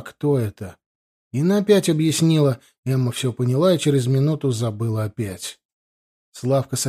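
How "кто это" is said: narrator's mild effortful question; a touch of struggling to speak; laboured, drawn-out words. A narrator's reading. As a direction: stretch the words slightly, as if speech is hard. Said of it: "спросила с трудом, растягивая слова".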